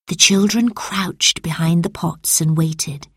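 ordinary delivery, nothing marked

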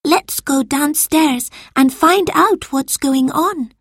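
put-on high, slow boy's voice; a boy proposing, hushed and careful; boy suggesting. A narrator's reading. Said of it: "suggested Marcus".

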